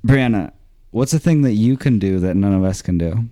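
ordinary delivery

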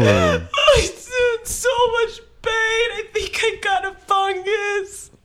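drawn-out comic whimper; mock agony. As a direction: whine it out through hammed-up pain. Injured customer whimpering in sketch.

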